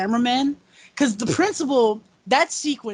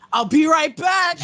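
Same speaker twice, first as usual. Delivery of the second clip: Stu quote; 5 semitones higher, 6 dB louder than usual. Retold or imitated. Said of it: "And Matthew Lillard".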